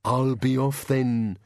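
slow, drawn-out monster voice; dragging creature farewell; departing bogeyman. Acted. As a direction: say it slowly as a slinking bogeyman. Bogeyman takes its leave.

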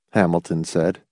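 usual speaking voice

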